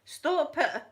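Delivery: high, loud self-rebuke in her head; scolding herself. Retold or imitated. Scolding herself inwardly for staring, high and loud. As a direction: tell yourself off sharply for staring. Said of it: "I'm thinking to myself".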